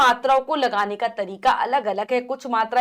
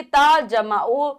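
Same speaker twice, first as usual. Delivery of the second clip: very slow, each part held apart; slow spelling out; 3 semitones higher, 3 dB louder, much slower than usual. Shown as a demonstration. Teacher slowly spelling a syllable for children. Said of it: "ओ की मात्रा बच्चों".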